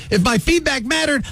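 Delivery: ordinary talk, nothing marked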